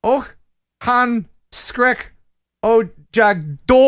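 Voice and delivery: halting Swedish, word by word with gaps; attempted Swedish reading. Shown as a demonstration. Host struggles through a Swedish limerick, halting attempted Swedish. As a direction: pick through the Swedish words carefully. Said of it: "actually read it in Swedish".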